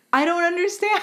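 high, panicked mock yell; mock scream. You yelling at the ghost, high panicked mock scream. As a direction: squeal it out, lost and confused. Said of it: "standing there screaming".

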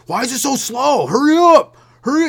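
high, loud impatient rider; imitated yelling. Comedian imitating an angry passenger yelling at the bus driver. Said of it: "He's, like, yelling at the bus driver".

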